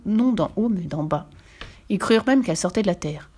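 ordinary delivery

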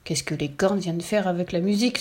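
ordinary delivery, nothing marked

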